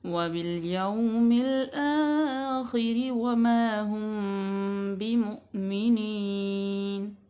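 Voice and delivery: slow melodic tajweed chant; Quran recitation. Shown as a demonstration. Teacher recites the verse for students, slow melodic chant. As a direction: draw each vowel out in recitation.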